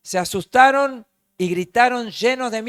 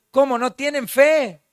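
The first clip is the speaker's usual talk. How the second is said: chiding question; 6 semitones higher, 4 dB louder than usual. Acted. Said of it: "le dicen".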